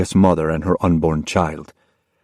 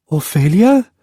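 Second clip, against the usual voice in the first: mother's questioning call; 12 semitones higher, 4 dB louder than usual. Acted. Mother asks daughter for explanation.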